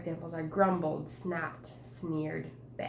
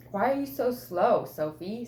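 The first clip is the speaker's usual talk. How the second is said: higher, louder impatient snap; snappy; 3 semitones higher, 7 dB louder than usual. Shown as a demonstration. Teacher demonstrates the brothers' snappy tone. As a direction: bite the words off impatiently. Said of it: "they said it in a snappy way".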